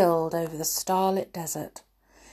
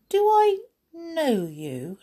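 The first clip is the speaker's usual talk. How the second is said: high, slow wondering question; curious princess; 11 semitones higher, slower than usual. Acted. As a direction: ask it half suspicious. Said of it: "she asked".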